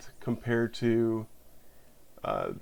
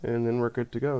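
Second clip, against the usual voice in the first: ordinary talk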